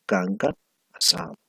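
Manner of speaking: normal talking voice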